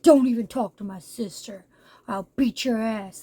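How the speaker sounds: half-hearted tough brother voice; overprotective brother. Retold or imitated. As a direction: growl a lightly acted warning at the guy. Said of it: "if he was her brother and be like".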